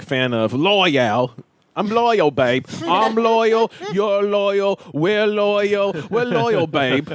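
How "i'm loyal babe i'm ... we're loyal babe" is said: put-on high, thick British islander accent; switching accents clumsily. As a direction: chant loyal like a drunk islander. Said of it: "I wasn't sure what word you were saying".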